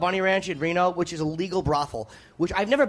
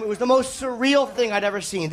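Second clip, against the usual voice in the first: ordinary delivery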